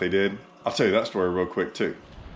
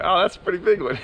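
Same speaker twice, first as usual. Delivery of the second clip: excited exclaim; 9 semitones higher, 4 dB louder, faster than usual. Retold or imitated. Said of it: "I'm like".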